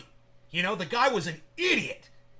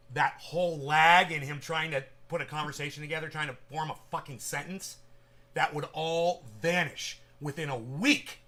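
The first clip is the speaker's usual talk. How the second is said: normal talking voice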